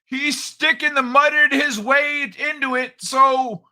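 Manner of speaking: put-on high, loud old-man character voice; Old Man Kai voice. Host ribbing his co-host, as a high, loud old man.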